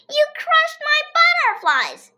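put-on high, loud wail of a hurt girl; upset girl's cry. A narrator's reading. Andrea wails at AJ over her butterflies. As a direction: cry it out, outraged.

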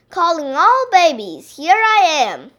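loud, high, swooping announcement; calling out. A narrator's reading. Grandpa announcing himself at school, calling out. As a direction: hail everyone like a big public call.

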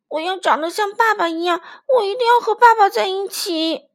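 put-on high, loud, childlike insistence; a small dino shouting its wish; eager little dinosaur. Little dinosaur tells the tyrannosaurus, high and loud.

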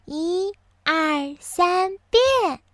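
fairy's drawn-out count rising to a high finish; 仙女念咒. A narrator's reading. 仙女再次施魔法. (仙女念咒，音调越来越高)

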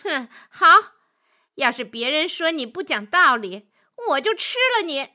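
huff, then threaten your prey; disdainful wolf, rising to a high threat; scornful huff. A narrator's reading. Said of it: "狼哼了一声".

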